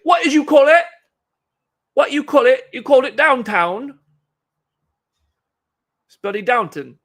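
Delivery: high, loud, indignant Londoner impression; mock London accent. Imagined Londoners scolding a visitor over Downton, high shouted London accent. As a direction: shout it as an outraged caricature Londoner. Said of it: "No, they're gonna be like".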